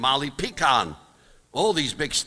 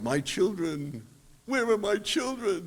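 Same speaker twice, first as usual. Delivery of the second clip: hammy tragic actor crying out, slow and higher; mock dramatic yell; 8 semitones higher, 4 dB quieter than usual. Yiddish actor calling to his lost children, lightly hammed. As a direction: plead like an old stage tragedian. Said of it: "where he yelled".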